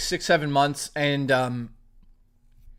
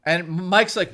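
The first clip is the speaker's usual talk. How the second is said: usual speaking voice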